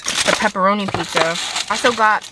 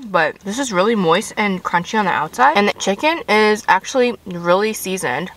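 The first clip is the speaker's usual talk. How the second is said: eating between sentences; chewing while talking. Real, not acted. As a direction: mouth partly full. Seen 2: Bites at +7.1, chews between phrases, chicken bake always in hand.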